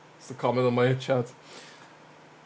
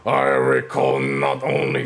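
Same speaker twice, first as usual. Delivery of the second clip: shrill screeching bird woman; 11 semitones lower than usual. Acted. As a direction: croak it out like an old bird man. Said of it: "let me get that voice".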